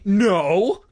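loud, high-pitched protest. A narrator's reading. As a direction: burst out with an objection. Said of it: "Greg protested".

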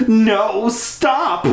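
put-on shrill girl scream, high pitched; high screech. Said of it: "Stephanie screeched".

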